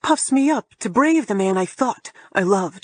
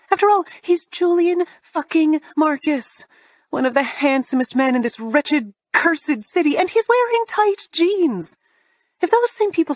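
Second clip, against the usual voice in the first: rising higher, building to disbelief; exasperated rant; 8 semitones higher than usual. A narrator's reading. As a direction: climb in pitch with outrage. Exasperated rant about Julian.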